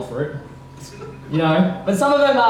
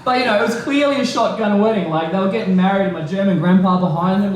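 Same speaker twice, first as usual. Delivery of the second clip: normal talking voice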